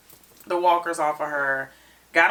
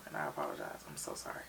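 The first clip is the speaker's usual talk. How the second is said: whispered apology; 13 semitones lower, 15 dB quieter, faster than usual. Retold or imitated. Negan apologizing to Maggie.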